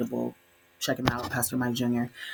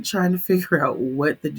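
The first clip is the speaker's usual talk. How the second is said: usual speaking voice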